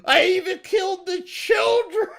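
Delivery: high, hammed-up cry of remorse; horrified wailing. A killer realising he killed children, mock-anguished wail. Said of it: "And then he's like".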